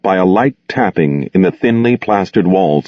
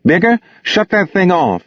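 high, impatient call across the room; 10 semitones higher than usual. Acted. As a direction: sing it out, impatient with him. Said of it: "sang out impatiently".

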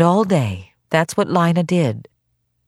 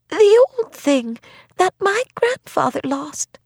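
put-on feverish old woman voice.

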